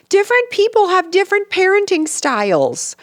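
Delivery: high-pitched mock quote; mocking imitation. Mother quoting castmate on parenting, mocking imitation. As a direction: parrot it in a high, held voice.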